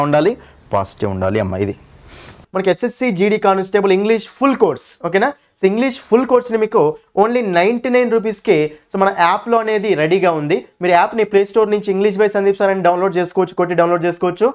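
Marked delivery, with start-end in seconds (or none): 2.5-14.5 upbeat sales pitch, pitched higher; teacher plugging his course app to students, lively ad read. Acted.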